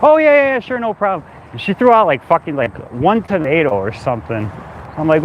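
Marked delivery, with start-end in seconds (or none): 0.0-1.3 breezy roommate voice. Retold or imitated.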